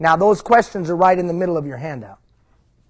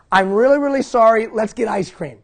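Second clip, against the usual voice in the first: higher, louder, pleading then bribing with a laugh; eager apology; 5 semitones higher, 6 dB louder than usual. Retold or imitated. Dad hurrying to his daughter at the stairs, eager apology. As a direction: rush to make it right, then offer the treat. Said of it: "I said this".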